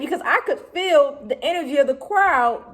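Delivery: normal talking voice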